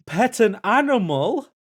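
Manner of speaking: high, loud, slow shocked gasp of a question; recoiling in disbelief at the task card. Retold or imitated. Reading the task and reacting in shock, loud and high. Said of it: "said in a shocked voice".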